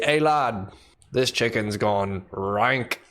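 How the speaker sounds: mock British accent, slow and deliberate. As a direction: play a British bloke returning bad chicken. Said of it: "how was my British accent?".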